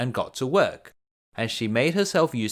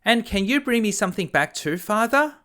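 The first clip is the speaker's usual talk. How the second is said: put-on very high, pleading girl voice; whiny younger daughter; 9 semitones higher, 3 dB louder than usual. A narrator's reading. Second daughter begging father for a gift, high and whiny. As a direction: beg father in a thin high voice. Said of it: "said the second daughter".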